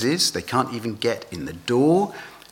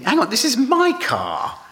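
much higher, mock indignant outburst; indignant protest; 13 semitones higher, 3 dB louder than usual. Car owner objecting to another forgiving his dent, mock indignant. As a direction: play the outraged car owner.

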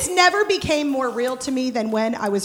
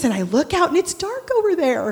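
ordinary talk

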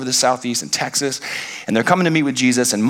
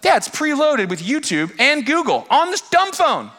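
excited daughter; 9 semitones higher than usual. Retold or imitated. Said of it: "She gives me the phone".

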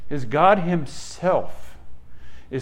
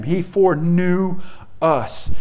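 normal talking voice

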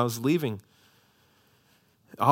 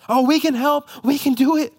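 mock eager disciples; 11 semitones higher, 7 dB louder than usual. Disciples mocked, overeager.

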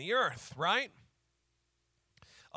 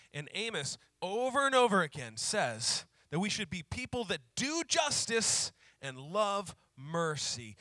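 normal talking voice

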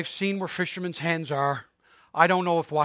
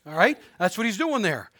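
usual speaking voice

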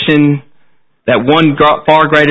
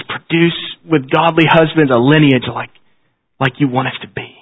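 prayer. Acted. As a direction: pray humbly to close the service. Closing prayer after "Let's pray.

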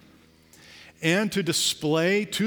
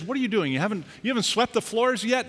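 higher exasperated boss voice; mock boss; 6 semitones higher, faster than usual. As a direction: play the baffled boss scolding lightly. Boss scolding new worker.

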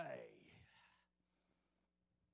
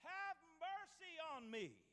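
high pleading cry for mercy; a beggar crying out to Jesus from the roadside; 10 semitones higher than usual. Acted. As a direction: call out desperately, pitch leaping up. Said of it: "he began to cry out and say".

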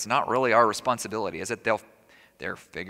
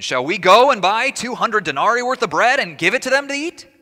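higher, louder mocking retort; sharp sarcastic edge; 8 semitones higher, 7 dB louder than usual. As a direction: snap back at Jesus with biting sarcasm. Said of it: "you hear that sharp edge on their voice".

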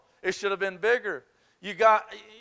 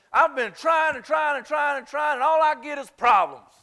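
higher, louder whiny shout; mock complaint; 7 semitones higher, 5 dB louder than usual. Preacher imitating his own grumbling, raised and whiny. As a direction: whine it out loud.

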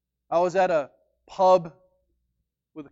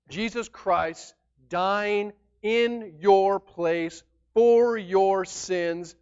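drawn out, each word weighed; slow down to land the core message; slow emphasis; much slower than usual. Real, not acted. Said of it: "slows to stress the gospel".

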